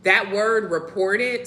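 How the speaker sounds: normal talking voice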